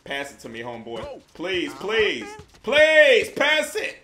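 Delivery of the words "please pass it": higher and louder plea; pleading shout. Real, not acted. Begging a ball-hog teammate to pass, loud plea. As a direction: beg loudly for the ball.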